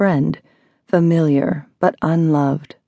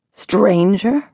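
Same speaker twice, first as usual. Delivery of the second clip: annoyed; 4 semitones higher than usual. A narrator's reading. Caller echoing the word stranger back, annoyed. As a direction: repeat it as an annoyed, rising question. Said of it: "a rasp of annoyance in the repetition".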